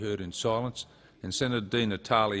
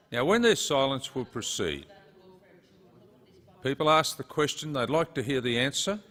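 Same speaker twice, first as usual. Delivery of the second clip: ordinary delivery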